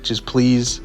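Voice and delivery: normal talking voice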